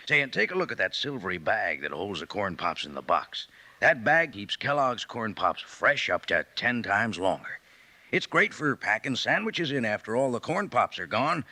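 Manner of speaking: normal talking voice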